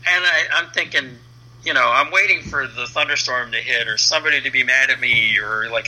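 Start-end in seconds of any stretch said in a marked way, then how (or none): none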